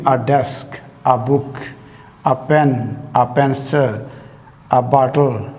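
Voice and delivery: usual speaking voice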